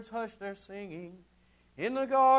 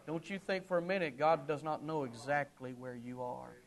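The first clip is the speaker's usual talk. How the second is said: ordinary talk, nothing marked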